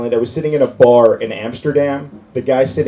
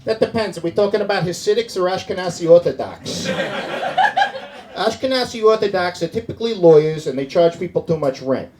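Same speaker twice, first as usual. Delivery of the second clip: Staten Island guy; 6 semitones higher than usual. Retold or imitated. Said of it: "racist guy from Staten Island".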